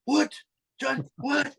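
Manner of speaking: high, disbelieving protest; can't believe he's just been fired; shocked. Retold or imitated. Teen just fired by mob boss, high shocked protest.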